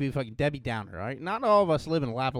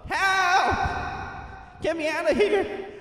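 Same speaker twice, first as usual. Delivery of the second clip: high, echoing calls for help from a pit; stuck in a hole; 13 semitones higher, 4 dB louder, much slower than usual. Pretend. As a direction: cry out as if trapped down a hole, half laughing. Pretends trapped in echo.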